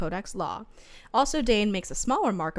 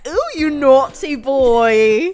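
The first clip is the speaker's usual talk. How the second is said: high, slow, squealing tease; bubbly sorority girl; 7 semitones higher, 12 dB louder, much slower than usual. Retold or imitated. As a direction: drag out naughty in a giddy squeal. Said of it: "I went".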